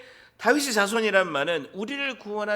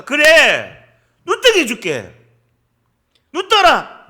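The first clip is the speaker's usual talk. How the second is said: loud, raised-pitch proclamation; preacher booming out the Lord's answer; commanding shout; 6 semitones higher, 14 dB louder, slower than usual. Acted. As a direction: call out the healing command with full authority. Acting out Jesus's commanding answer.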